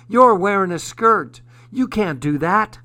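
loud, high-pitched outrage; ranting. A narrator's reading. Woman confronts father and son in skirts, ranting. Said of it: "One woman approached them ranting".

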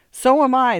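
loud, high-pitched comeback; angry retort. A narrator's reading. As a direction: fire back in irritation. Man retorts in domestic quarrel.

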